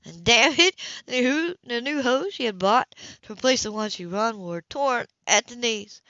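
ordinary delivery, nothing marked

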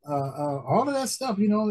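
ordinary talk, nothing marked

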